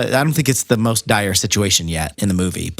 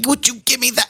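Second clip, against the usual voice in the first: much higher, lightly hammed-up Stooges bicker; Three Stooges squabble; 13 semitones higher than usual. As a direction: play it as a goofy slapstick tussle. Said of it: "Three Stooges".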